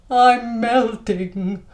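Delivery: very slow, drawn-out complaint; exaggerated, dragged-out agreement; stronger agreement. Shown as a demonstration. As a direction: stretch the words as if wilting in heat. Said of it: "statement that's a little bit stronger".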